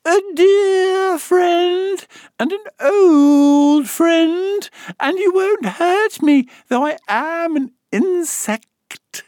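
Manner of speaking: very high, slow, loud squeak; little voice. A narrator's reading. Gnat appealing to Alice, slow high little voice. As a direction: draw it out in a tiny creature's pitch. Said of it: "the little voice went on".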